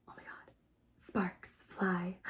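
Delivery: breathless whisper of a crush; hushed whisper. Acted. Fan whispering as sparks fly during the handshake. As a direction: whisper it like a secret thrill.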